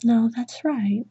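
ordinary delivery

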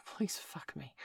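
breathy whispered begging; whispered plea. A narrator's reading. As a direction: drop to a whisper and beg. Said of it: "Bailey pleaded".

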